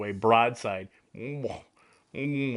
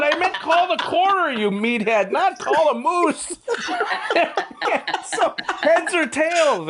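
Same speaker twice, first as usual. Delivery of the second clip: laughing; 6 dB louder, slower than usual. Real, not acted. Punchline of the funny story.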